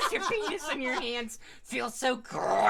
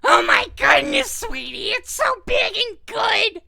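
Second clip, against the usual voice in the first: loud, breathless fake passion; mock ecstatic shouting, much louder; hammed-up loud moaning; 13 dB louder than usual. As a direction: overact it like a cheesy porn scene. Hammed-up sex scene voicing.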